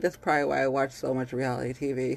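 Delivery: usual speaking voice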